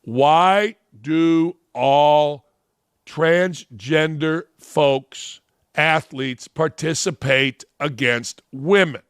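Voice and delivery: very slow, every word drawn out and spelled out; slow it right down as if to a child; said slowly. Shown as a demonstration. Mocking Simone, he slows right down to talk down to her. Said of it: "I'm gonna say it slowly".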